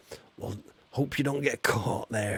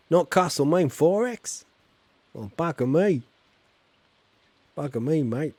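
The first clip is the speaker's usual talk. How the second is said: put-on Australian accent; 4 semitones higher, 4 dB louder than usual. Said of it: "they actually don't say shrimp".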